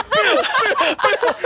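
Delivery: high, slow chanted alert jingle; sing-song imitation of a notification; imitating the alert sound. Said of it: "it goes".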